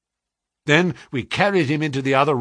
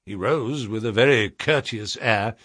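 plain reading voice

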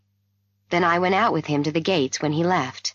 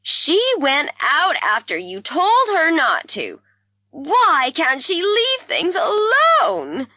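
high, slow distressed wail; very upset; 12 semitones higher, 4 dB louder, slower than usual. A narrator's reading. Mother crying out about her daughter's disobedience, very upset. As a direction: wail it out, pitch soaring. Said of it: "very upset".

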